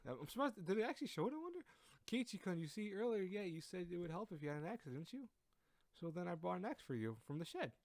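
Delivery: put-on high-pitched, apologetic girl voice; eager high-voiced girl, a bit flustered; anxious girl character. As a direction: explain yourself in a high, girlish voice. Voices Rena in visual novel.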